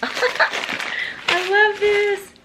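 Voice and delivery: high, slow, laughing it out; real laughing gush; laughing delight. Real, not acted. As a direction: giggle through the words in joy. Laughing over a gift.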